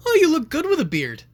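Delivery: put-on high, loud gushing old-lady voice; mock-delighted great-grandmother. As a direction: gush over the photo.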